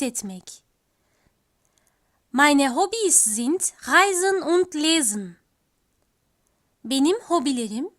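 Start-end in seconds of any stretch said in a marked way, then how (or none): none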